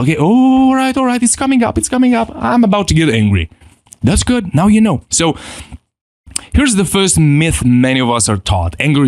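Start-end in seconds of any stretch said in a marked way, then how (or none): 0.0-3.5 higher, gentle noticing aloud; soothing inner voice; calm self-acknowledgment. Shown as a demonstration. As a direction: talk yourself down as it comes. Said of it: "if you acknowledge yourself".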